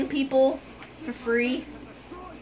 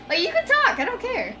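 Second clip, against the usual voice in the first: high-pitched pleading; 10 semitones higher than usual. Real, not acted. Reassuring Emmett, mock pleading.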